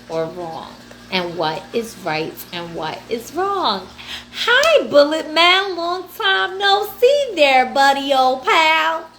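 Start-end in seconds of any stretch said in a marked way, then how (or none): 4.3-9.1 much higher and louder, stretched out slowly; silly greeting. Pretend. Greeting Bullet Man like a long-lost buddy, goofy and high. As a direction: call out to an old pal in a goofy voice.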